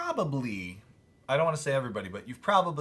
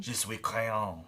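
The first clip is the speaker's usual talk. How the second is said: mock French accent; slower than usual. Mock French answer in accent teasing.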